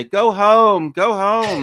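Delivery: high, loud mock sign-off; imitating a showbiz host shooing the crowd; high loud imitation of Tracy Ullman. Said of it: "Tracy Ullman ... she'd say".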